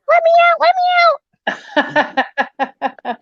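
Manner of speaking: high-pitched mock cry for help; voice from the grave. Tombstone sound bite for passers-by, trapped little voice. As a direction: squeal like someone trapped inside.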